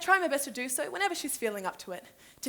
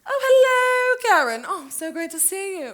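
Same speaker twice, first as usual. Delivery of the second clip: loud, high, long-drawn hello; effusive, showy delight; gushing greeting; 7 semitones higher, 10 dB louder than usual. Acted. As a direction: gush over a friend spotted by surprise. Rival friend turns up at bridge.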